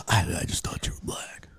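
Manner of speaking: sheepish, voiceless whisper; imitated whisper. Fan explaining himself to the comic, whispered. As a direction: whisper it, stumbling into the confession. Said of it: "why are you whispering this".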